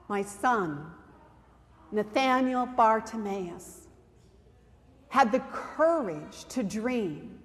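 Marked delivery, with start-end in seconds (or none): none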